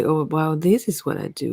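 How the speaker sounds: normal talking voice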